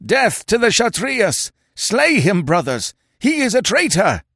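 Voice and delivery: high, vengeful mob shouts; howling. Acted. Warriors turn on Conan as a traitor, howling. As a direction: urge the others on to kill. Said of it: "they howled".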